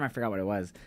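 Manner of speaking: usual speaking voice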